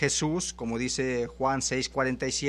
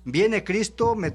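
fervent pulpit cry; impassioned preaching; 3 semitones higher than usual. Acted. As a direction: preach it out with fire to the congregation. Preaching at full force.

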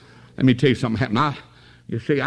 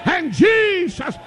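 preaching at full force. Acted. Preacher exalting Jesus to the congregation, full-force preaching. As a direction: lift it up and ring it out to the church.

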